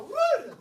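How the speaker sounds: high, loud "woo" of sheer excitement; excited whoop. Shown as a demonstration. Teacher showing kids how excited reading makes him, excited whoop. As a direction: burst out with a whoop. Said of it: "I get so excited that I go".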